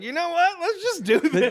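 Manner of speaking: high-pitched mock bravado. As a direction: squeak it out as a playful impression. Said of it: "You're like".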